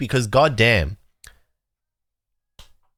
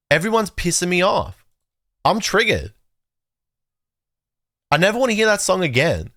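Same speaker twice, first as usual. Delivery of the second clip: exasperated rant; 7 semitones higher than usual. Real, not acted. Ranting at chat and a hated song.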